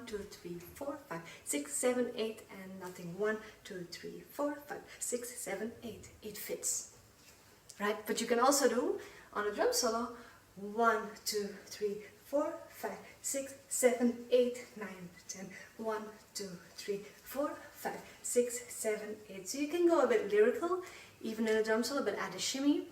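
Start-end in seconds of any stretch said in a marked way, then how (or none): 0.1-6.1 counting the beat. Shown as a demonstration. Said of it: "counting through drum solo". 10.6-19.5 counting the beat. Shown as a demonstration. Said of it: "counting through drum solo".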